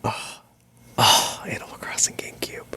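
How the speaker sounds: soft reverent whisper. Real, not acted. Remembering the game in a whisper. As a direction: breathe the title like a fond memory. Nostalgic aside.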